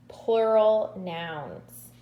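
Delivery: plural nouns drawn out slowly for emphasis; slow emphatic. Shown as a demonstration. Teacher introducing plural nouns to kindergartners, slowly. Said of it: "introducing the new topic".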